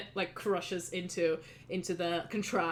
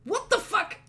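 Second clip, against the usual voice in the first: high, startled yell from the kitchen; a hammed-up shout of disbelief; mock outburst; 13 semitones higher, 5 dB louder than usual. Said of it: "Atan goes back into the kitchen and goes like".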